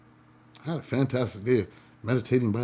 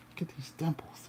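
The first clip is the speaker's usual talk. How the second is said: whisper; 4 semitones higher, 12 dB quieter than usual. Pretend. Teasing an imagined hater.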